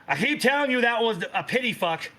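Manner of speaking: high-pitched mock-indignant retort; hammed-up marital bickering; exasperated mother. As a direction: correct him like a fed-up wife. Mother snaps back at doting father.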